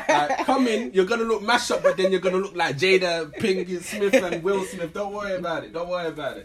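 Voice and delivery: high, loud, laughing sales pitch; mock salon ad. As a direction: hype it like a cheesy ad. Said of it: "should probably not be your little slogan".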